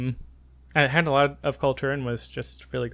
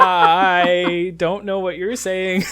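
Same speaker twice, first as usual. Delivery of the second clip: slow, drawn-out, higher-pitched confusion; baffled; 5 semitones higher, 4 dB louder, much slower than usual. Retold or imitated. Tourist to pharmacist he cannot understand, slowly baffled. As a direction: stretch it out, voice raised in pitch.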